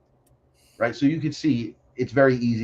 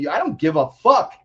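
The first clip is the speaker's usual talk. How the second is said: defiant comeback; 8 semitones higher, 4 dB louder than usual. Retold or imitated. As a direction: pitch climbing into brash defiance. Acted defiant comeback in argument.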